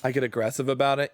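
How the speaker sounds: normal talking voice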